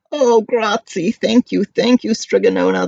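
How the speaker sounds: high, relieved thanks; grateful cry. Acted. The people thank Strigonona, high and relieved. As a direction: gush with relief. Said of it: "the people cried".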